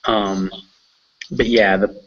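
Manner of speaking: ordinary talk, nothing marked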